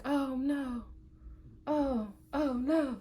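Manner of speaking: high, soft "oh no" of dismay; hushed, high-pitched mock horror. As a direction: watch helplessly as it flies away. Watching thrown phone, retold.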